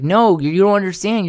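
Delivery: usual speaking voice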